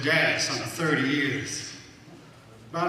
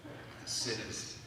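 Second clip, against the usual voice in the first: normal talking voice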